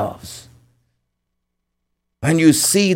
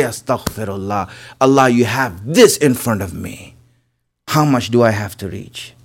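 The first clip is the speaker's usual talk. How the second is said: softer, humble plea for forgiveness; hushed plea; 8 semitones lower, 6 dB quieter than usual. Retold or imitated. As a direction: murmur it as a humble prayer.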